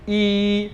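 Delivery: ई held long and steady; flat-mouthed ई. Shown as a demonstration. Teacher modelling pinyin y, long flat-mouthed ई. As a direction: spread the mouth flat and hold ई. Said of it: "मुंह को flat करेंगे".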